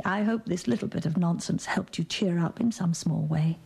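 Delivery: ordinary talk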